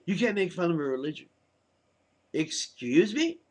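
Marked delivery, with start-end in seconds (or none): 2.3-3.4 indignant excuse me. Real, not acted. Indignant at UN resolution.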